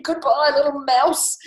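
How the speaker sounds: high, loud nervous farewell; fleeing snake. Acted. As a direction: call goodbye in mock alarm.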